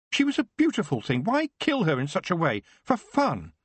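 put-on high, hurt boy's protest; a wounded boy asking why; upset Geoffrey. Son protesting the killing to his mother, high and hurt.

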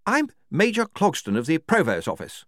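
brisk official character voice; Major Clogston, brisk officer. A narrator's reading.

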